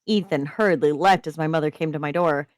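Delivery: ordinary delivery, nothing marked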